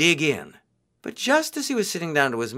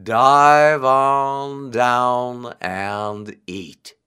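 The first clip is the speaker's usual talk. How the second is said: very slow, loud turtle voice; 6 dB louder, much slower than usual. A narrator's reading. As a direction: invite him down with slow relish. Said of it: "he said".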